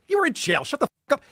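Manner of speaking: high, incredulous retort; retold disbelief. Host retelling his reply to Aaron, high incredulous scoff. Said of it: "I was like".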